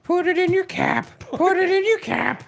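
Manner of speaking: squeaky joke voice. Pretend. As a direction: squeak it out like a silly cartoon. Joking squeaky voice about script.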